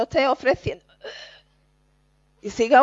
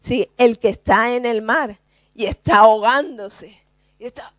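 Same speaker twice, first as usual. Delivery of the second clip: ordinary talk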